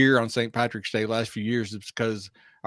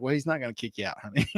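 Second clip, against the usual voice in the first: mock soothing; 6 dB quieter, faster than usual. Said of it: "And I'm like".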